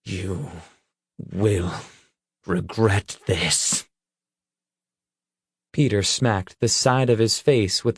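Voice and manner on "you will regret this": low outraged threat. A narrator's reading. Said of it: "his lips trembling with outrage".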